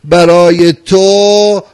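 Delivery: loud, slow and high, every phrase hammered out; full-force preaching. Acted. Preacher to mourners on a Muharram night, loud and slow. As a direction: preach it to the hall, drawn out and loud.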